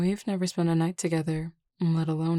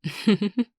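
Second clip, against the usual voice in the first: short, higher-pitched chuckle; amused chuckle; 4 semitones higher, 4 dB louder than usual. Acted. Flirting with the caller over the phone, amused chuckle. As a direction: let out a little teasing laugh.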